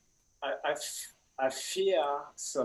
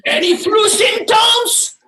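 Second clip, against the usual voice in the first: hammed-up bouncer shout; 10 semitones higher, 11 dB louder than usual. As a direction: grill them like a gatekeeper. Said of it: "And I go".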